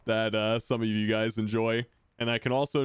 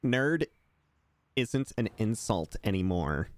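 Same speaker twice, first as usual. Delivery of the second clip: nerd voice. Shown as a demonstration. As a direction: correct them like a prim nerd. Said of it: "I do like to pull out the nerd, uh, voice".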